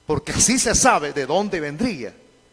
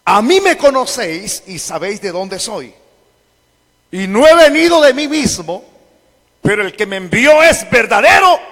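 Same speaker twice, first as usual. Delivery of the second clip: loud, higher-pitched preached proclamation; raised voice; 3 semitones higher, 8 dB louder than usual. Acted. Preacher quotes Jesus teaching in the temple, raised voice. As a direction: cry out the verse, rising in force. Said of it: "alzó la voz y dijo".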